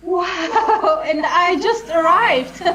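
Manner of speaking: laughing. Acted. Flattered by compliment.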